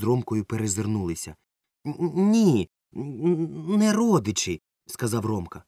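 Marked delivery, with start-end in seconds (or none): 1.9-4.6 stammering. A narrator's reading. Frightened boy stammers denial.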